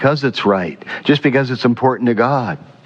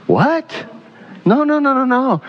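incredulous protest; 13 semitones higher than usual. Real, not acted. Preacher rejects the mocked attitude.

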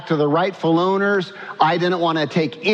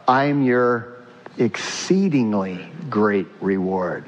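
lower, slow and hushed; solemn promise; 8 semitones lower, slower than usual. Acted. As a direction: draw out the promise tenderly. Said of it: "Not, as if that's not enough".

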